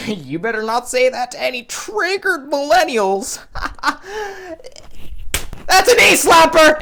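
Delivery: smug boomer cracking himself up; high guffawing gloat; cackling at his own joke. Retold or imitated. Idiot-faced boomer laughing at own joke.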